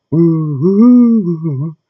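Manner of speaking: high wailing "woo", loud then fading; imitated siren wail. Vlogger mimicking sirens in the big city, siren wail.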